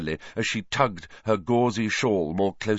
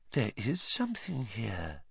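much softer, hushed mystic voice; whispered; 3 semitones higher, 10 dB quieter than usual. A narrator's reading. Trelawney whispering over the ball, hushed and eerie. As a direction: breathe it over the crystal ball. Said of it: "Professor Trelawney whispered".

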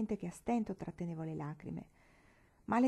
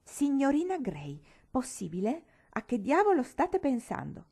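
loud, higher scolding cry; a father's sharp rebuke; harsh exclamation; 6 semitones higher, 6 dB louder than usual. Acted. Mr Bloomfield scolding the governess, harsh and loud. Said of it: "una voce aspra dietro di me esclamare".

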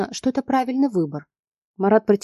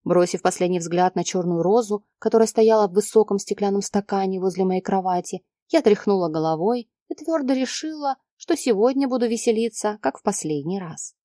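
usual speaking voice

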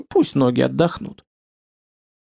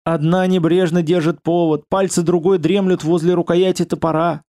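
ordinary delivery

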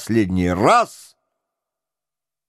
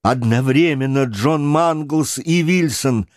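plain reading voice

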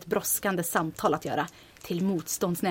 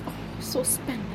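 whisper; 2 semitones higher, 6 dB quieter than usual. Acted. Whispered aside in radio drama.

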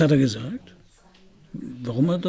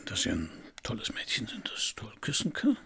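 softer, hushed compliment; tender whisper; 4 semitones lower, 7 dB quieter than usual. Acted. Detective compliments Jeanne in a tender whisper. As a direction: whisper it close to her ear.

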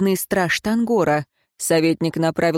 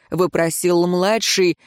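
plain reading voice

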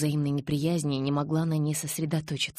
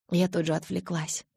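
ordinary delivery, nothing marked

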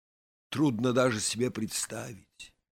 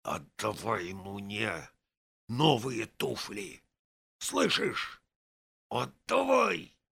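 hissing demand; slower than usual. A narrator's reading. Vaska hisses right into his friend's ear, menacing. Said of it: "прошипел мне в самое ухо".

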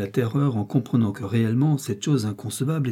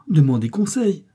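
higher, louder appeal; pleading; 6 semitones higher, 5 dB louder than usual. A narrator's reading. Lise begging her stepmother for time, pleading. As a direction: plead urgently, voice lifted.

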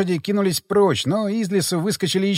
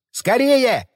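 urgent shout; 7 semitones higher than usual. A narrator's reading. Hero shouts to the stuck pastor to hurry, urgent shout. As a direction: shout it out, drawn-out and high. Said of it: "закричал я".

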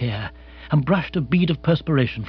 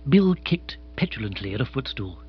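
ordinary delivery, nothing marked

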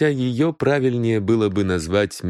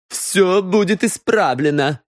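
raised, firm; defiant retort; 10 semitones higher than usual. A narrator's reading. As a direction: snap back certain. Daughter retorts. (дерзкий ответ дочери)

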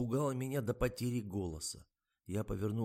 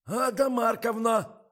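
очень громкий высокий окрик; кричит глухой старухе; вопль; 14 semitones higher, 12 dB louder, slower than usual. A narrator's reading. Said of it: "от его вопля". (громкий протяжный вопль)

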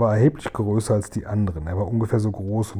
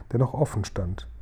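ordinary delivery

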